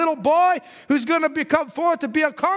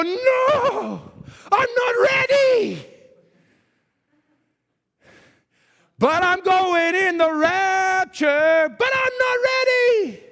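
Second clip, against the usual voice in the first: high, frantic plea, much higher, slow and drawn out; panicked not ready; 6 semitones higher, much slower than usual. Acted. Preacher acting a churchgoer caught unready for the rapture, high frantic plea. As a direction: wail it like someone caught unprepared.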